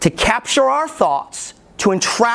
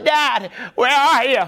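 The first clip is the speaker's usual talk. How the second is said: imagined crying out; 4 semitones higher than usual. Retold or imitated. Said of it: "they're crying out".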